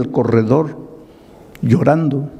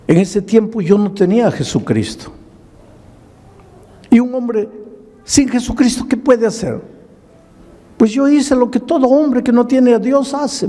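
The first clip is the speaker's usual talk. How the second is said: preaching; 10 semitones higher than usual. Retold or imitated. Testimony rising into preaching.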